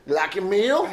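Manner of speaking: loud, high hammed-up Southern waitress; mock Southern accent. Horse-riding Southerner to a foreign diner, mock Southern accent. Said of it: "Is that your Southern accent?".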